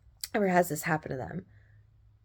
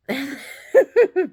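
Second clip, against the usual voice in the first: amused laugh; 9 semitones higher, 8 dB louder than usual. Real, not acted. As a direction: burst of high, loud laughter. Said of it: "the reason why I'm laughing".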